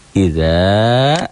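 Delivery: "Idza" with a long rising final a; mad tabi'i. Shown as a demonstration. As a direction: hold the end of "Idza". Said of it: "za-nya juga mad tabi'i". ("Idza" dipanjangkan)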